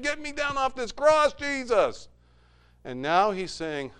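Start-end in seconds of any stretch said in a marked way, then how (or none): 0.0-2.1 higher, a demanding cry; angry demand. Acted. Angry thief yelling at Jesus from his cross. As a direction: bark the demand at him.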